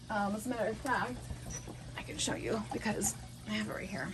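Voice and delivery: softly showing the wand up close to the camera; hushed aside to the camera; quiet show-and-tell. Real, not acted. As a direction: murmur it while holding the wand up.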